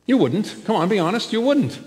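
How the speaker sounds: usual speaking voice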